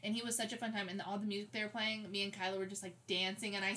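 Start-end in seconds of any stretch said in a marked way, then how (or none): none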